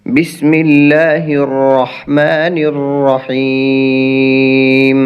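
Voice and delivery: slow, drawn-out chanted recitation; Quranic recitation. Acted. Teacher opening the lesson with a slow, chanted recitation. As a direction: intone it slowly and reverently. Opens with ta'awwuz and basmala.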